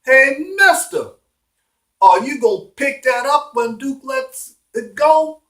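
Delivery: acted yell from a window; yelling down below. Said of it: "So Davy yells down below".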